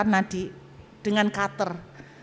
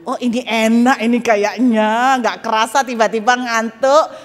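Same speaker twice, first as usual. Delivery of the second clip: mock blissful; 7 dB louder than usual. Imagining an easy death as on TV, mock blissful. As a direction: pretend to be blissfully drifting off.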